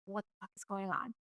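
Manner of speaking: very soft, under-the-breath bewilderment; confused aside. Retold or imitated. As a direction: replay a baffled thought, barely voiced. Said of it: "I was so confused".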